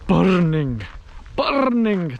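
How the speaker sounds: hammed-up Scottish exclamation; poor Scottish accent. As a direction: do a bad Scottish accent, drawing the word out. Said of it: "That was my poor Scottish accent".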